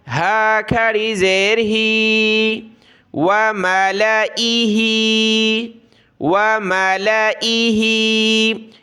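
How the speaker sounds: very slow syllable-by-syllable reciting; letter-by-letter recitation. Shown as a demonstration. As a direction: spell out each sound slowly like a teacher. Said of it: "इस अलिफ को बिल्कुल भी नहीं पढ़ना".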